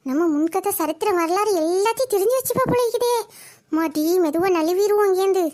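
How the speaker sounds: low-pitched worried aside to oneself; conspiratorial aside. Acted. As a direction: drop the voice and plan an escape. Aside, planning to slip away.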